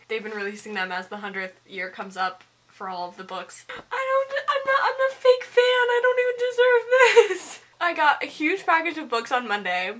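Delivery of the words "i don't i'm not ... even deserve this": very high wail, near tears; overwhelmed, tearful. Real, not acted. Vlogger feels undeserving of gifted books, tearfully overwhelmed.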